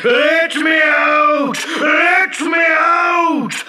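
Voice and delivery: very high, long-drawn genie shouts; calling out. Acted. Trapped genie calls for rescue, shouting loudly. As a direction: yell desperately to be released. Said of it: "I called out".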